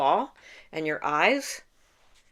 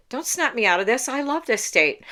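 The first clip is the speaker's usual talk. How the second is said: mock protest; 7 semitones higher, 4 dB louder than usual. Protesting being interrupted, imitated self.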